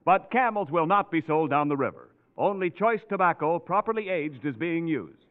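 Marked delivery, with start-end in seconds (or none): none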